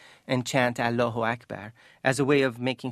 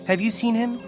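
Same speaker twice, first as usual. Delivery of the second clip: high, anxious question; pleading mother; 9 semitones higher, 4 dB louder than usual. Acted. Mother showing her son's photograph, pleading. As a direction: ask desperately, pitched well up. Mother asks about missing son.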